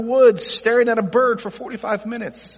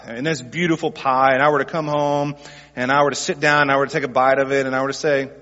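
usual speaking voice

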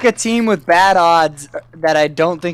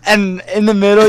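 usual speaking voice

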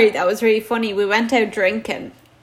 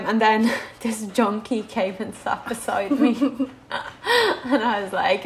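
ordinary delivery

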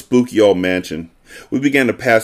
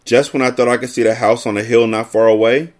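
ordinary delivery, nothing marked

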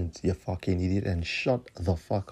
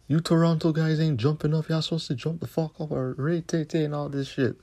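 higher whiny mock of the rapper; mock voice of the rapper, pitched up; 7 semitones higher than usual. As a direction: parody the rapper's complaint.

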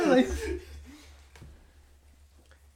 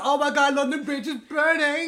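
mock British; 7 semitones higher, 4 dB louder than usual.

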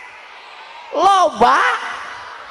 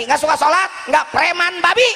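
higher, scornful comeback after the hushed warning; defiant retort; 4 semitones higher than usual. Acted. Preacher dismisses the feared thug, defiant raised voice. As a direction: rise sharply into a dismissive punchline.

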